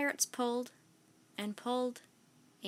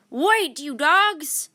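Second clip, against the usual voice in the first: captain wading after his crew; slow loud yell; 4 semitones higher, 10 dB louder, much slower than usual. A narrator's reading. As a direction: drag out a loud desperate yell. Captain chasing the boat.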